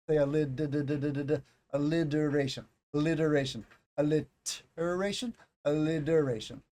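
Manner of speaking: alliteration with a slurred, flapped D; tongue further back, alliteration turns to lidda; lazy D sound. Shown as a demonstration. Teacher demonstrating the lazy American flap. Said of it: "a lazy, very quick way of saying".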